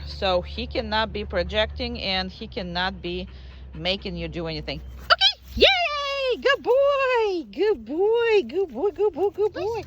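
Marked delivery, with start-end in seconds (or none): 5.0-9.8 very high, loud squeaky praise that slowly comes down; dog praise voice. Real, not acted. Trainer rewarding the dog for coming back, squeaky high praise. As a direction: gush over the dog in a sky-high voice.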